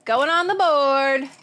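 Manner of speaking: loud high slow call; yelling. Real, not acted. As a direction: yell as if across a field. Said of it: "I'm yelling like you guys are far away".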